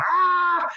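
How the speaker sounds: high, sustained imitation scream; mock scream. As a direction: imitate a movie character's pained scream. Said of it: "he starts screaming".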